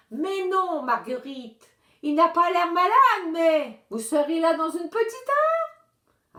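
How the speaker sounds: lightly voiced fussy old lady; old lady on phone. A narrator's reading. Madame Rose on the phone.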